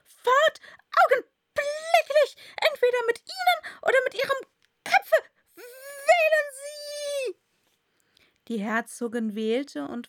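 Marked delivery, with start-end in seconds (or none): 0.0-7.4 shrieking, very high furious queen; evil queen. A narrator's reading. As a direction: shriek the ultimatum at full force. Furious queen shouts order.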